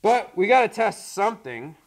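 muffled behind face mask; talking through gas mask. Real, not acted. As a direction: voice inside respirator. Seen 5: Both men wear full-face gas masks throughout the labelled words.

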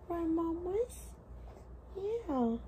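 high, slow cooing to a newborn. Real, not acted. Talking to baby in arms.

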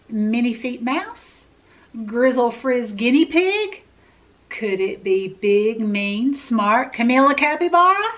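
ordinary delivery

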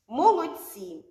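ordinary talk, nothing marked

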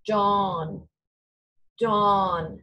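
"dawn" said loud and drawn out; modeling dawn. Shown as a demonstration. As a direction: hold the "aw" in "dawn" clearly. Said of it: "Say that".

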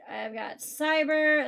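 normal talking voice

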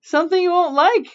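high, loud singsong call. A narrator's reading. Little Witch answers mother in the other room, calling out. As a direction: call back loudly to another room. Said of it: "called Little Witch".

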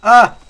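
sudden loud cry of surprise; startled yelp. Real, not acted. Startled by a mob.

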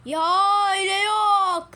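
slow, loud drawn-out scold. Real, not acted. Scolding child mishandling remote.

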